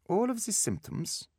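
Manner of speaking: put-on high-pitched German-accented doctor. A narrator's reading. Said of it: "his accent muted German".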